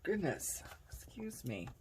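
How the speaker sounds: whispered, breathless after coughing; choked whisper after coughing fit; just swallowed a bug. Real, not acted. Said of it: "I swallowed a bug".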